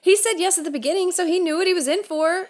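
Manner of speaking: high, fast and teasing; excited teasing. Real, not acted. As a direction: rattle it off, amused and high. Defending the splurge.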